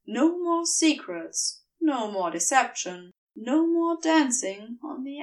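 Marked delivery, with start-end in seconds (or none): none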